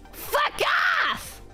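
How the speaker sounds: ordinary delivery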